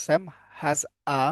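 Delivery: final word said as a short আ, not এ; reading pronunciation. Shown as a demonstration. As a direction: swap the এ for a light আ at the end. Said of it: "আ বলতে পারেন". (পড়ার সময় এ-র বদলে আ উচ্চারণ)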